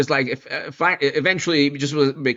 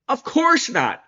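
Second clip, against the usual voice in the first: high-pitched, incredulous denial; exaggerated, high "of course not"; emphatic dismissal; 11 semitones higher, slower than usual. Real, not acted. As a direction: answer your own question as utterly absurd. Repeats answer more incredulously.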